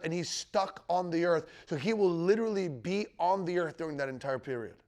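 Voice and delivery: normal talking voice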